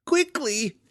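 very high-pitched creature voice; Clacker creature voice. A narrator's reading. Clacker agreeing to leave, high creature voice. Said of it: "agreed Clacker".

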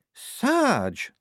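high, shocked exclamation; gasped-out protest, pitched very high; horrified. A narrator's reading. Shufti reacting to the sergeant's violence, horrified high cry.

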